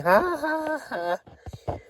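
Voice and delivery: high-pitched mock cackle; mock laughing. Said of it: "she was like, laughing".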